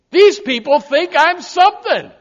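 loud, high burst of pride; mock boastful. First-time card holder bragging, mock boastful. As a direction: crow it with hammed-up self-importance.

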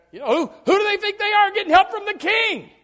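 loud, high resentful complaint; jealous indignation. Retold or imitated. Preacher voicing jealous people resenting the king's help. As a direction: sputter with envy.

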